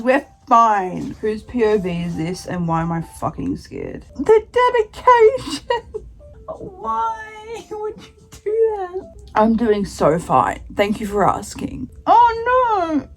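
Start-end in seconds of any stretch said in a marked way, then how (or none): 4.2-6.1 slow, high, tearful wail; crying. Real, not acted. Vlogger reading the dedication, real crying. 6.5-9.1 slow, high, soft and tearful; pleading through tears; soft sobbing. Real, not acted. Vlogger asking the author why, soft sobbing.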